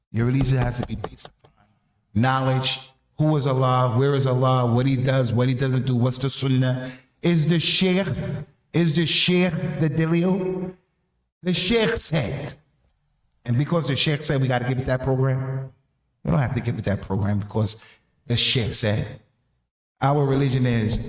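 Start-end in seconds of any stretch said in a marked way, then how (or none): none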